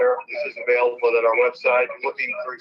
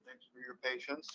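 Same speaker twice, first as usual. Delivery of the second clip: phone held away; 22 dB quieter than usual. Real, not acted. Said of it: "we're gonna do an audio bridge from my phone".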